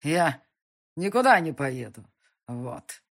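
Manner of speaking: put-on drowsy slurred drunken mumbling; sleepy drunk mumble. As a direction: mumble it half asleep and drunk. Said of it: "Бормотал сонно и пьяно".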